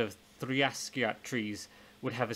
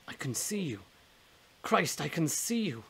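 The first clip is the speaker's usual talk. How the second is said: higher, slowing into astonished disbelief; amazed; 5 semitones higher than usual. Acted. Blind man suddenly sees his dead friend, amazed. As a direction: marvel at it, pitch lifting on the second line.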